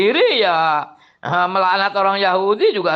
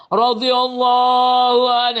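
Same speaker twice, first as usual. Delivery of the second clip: higher, slow blessing; reverent blessing; 4 semitones higher, slower than usual. Retold or imitated. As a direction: intone it with reverence. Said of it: "Ngomongnya apa?".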